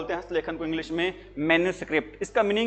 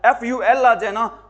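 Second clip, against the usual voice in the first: usual speaking voice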